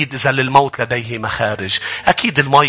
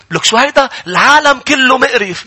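growing mock disgust; 8 semitones higher, 4 dB louder than usual. Grumbling grows, acted bigger.

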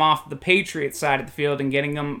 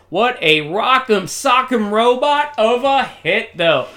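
higher, punchy exclamation; excited reaction to a big hit; impressed sportscaster; 5 semitones higher, 4 dB louder than usual. Pretend. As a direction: marvel out loud at the hit.

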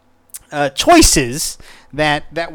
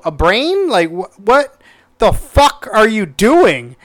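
exasperated rant; 6 semitones higher than usual. Real, not acted. Ranting at character.

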